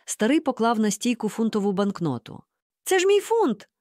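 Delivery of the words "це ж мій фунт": high-pitched indignant outburst; indignant hiss. A narrator's reading. Mom sees her pound being spent, hissing indignantly. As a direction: seethe in a much higher voice. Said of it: "прошипіла мама".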